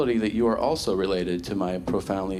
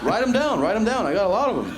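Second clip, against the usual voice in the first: much higher, excited mock urgency; eager engineer; 9 semitones higher, faster than usual. Imagined engineer eagerly begging for more ideas, much higher. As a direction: rush to get every idea down.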